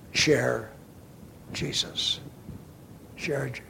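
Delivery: soft, spaced-out urging fading to a whisper; quiet plea, nearly whispered at the end; hushed appeal. Real, not acted. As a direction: drop your voice and let each word sink in. Preacher drops to a hushed appeal.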